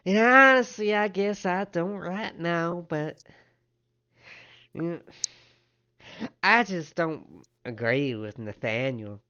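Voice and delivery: high, loud opening that settles down; counter woman, hedging. Acted. Woman at the desk admits disagreement, high then hedging. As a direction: draw out a high, hesitant "well, honestly".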